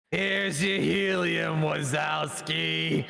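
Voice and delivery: slow, slightly high Roz drawl; a hint of Roz, dragged out; lightly voiced Roz. Shown as a demonstration. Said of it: "Say it like Roz with the helium".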